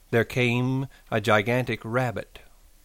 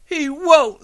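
very high, loud denial; frantic cry; 8 dB louder than usual. A narrator's reading. Lenny denying the rabbit's threat, frantic high cry. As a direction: cry out in panic. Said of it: "Lenny cried frantically".

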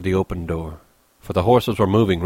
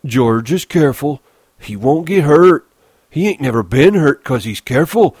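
put-on high, loud childlike protest; insistent Lennie; 7 semitones higher, 7 dB louder than usual. Lennie insists George is careful, high and upset. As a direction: defend him with swooping high pitch.

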